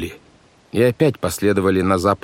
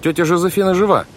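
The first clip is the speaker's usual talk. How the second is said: high-pitched, exasperated insistence; exasperated outburst; 11 semitones higher, 4 dB louder than usual. A narrator's reading. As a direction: blurt it out, patience gone. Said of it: "не выдержал Клаус".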